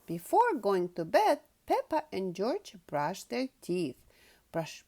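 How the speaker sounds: usual speaking voice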